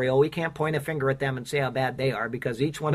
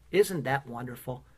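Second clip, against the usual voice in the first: usual speaking voice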